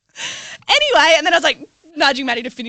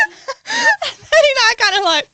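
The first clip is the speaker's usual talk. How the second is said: high, loud cry-laugh; laughing hard; 11 semitones higher, 5 dB louder, slower than usual. Real, not acted. Retelling the real estate guy, laughing hard. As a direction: lose it laughing.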